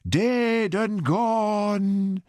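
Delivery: high, very slow theatrical wail. A narrator's reading. Fortune teller wails about her husband, high and drawn out. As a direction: wail it like a hammed-up mourner. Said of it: "she wailed".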